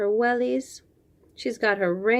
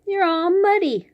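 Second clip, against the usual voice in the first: higher, fast playful mom voice; be the cheerful mom calling her muddy kid; mama voice; 9 semitones higher, 3 dB louder, faster than usual. Acted. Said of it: "Her mama says".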